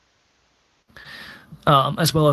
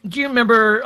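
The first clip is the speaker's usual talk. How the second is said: much higher, louder question; higher excited recall; 8 semitones higher, 4 dB louder, faster than usual. Acted. Talon asks Jenkins about old times, higher and louder. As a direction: lift it up eagerly. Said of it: "And he's like".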